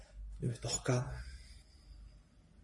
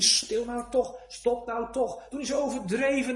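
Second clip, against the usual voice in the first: hushing bystanders; 6 semitones higher than usual. Acted. Bystanders hushing the crying sinner.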